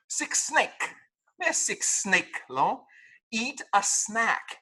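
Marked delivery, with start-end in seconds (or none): 0.1-0.9 sick snake whispered mockingly; mock wrong snake. Shown as a demonstration. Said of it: "you don't have a snake". 3.3-4.6 eat a snack, slow, short A in snack; correct snack. Shown as a demonstration. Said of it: "You're not gonna eat a snake".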